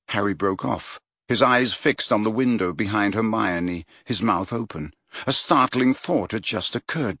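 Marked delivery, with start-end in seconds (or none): none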